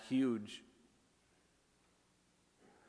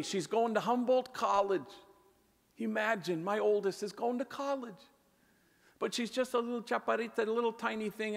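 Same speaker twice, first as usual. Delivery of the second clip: high, fast proud excitement; excited father; 9 semitones higher than usual. Retold or imitated. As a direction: gush like a thrilled dad. Bandit excitedly explains daughter's college.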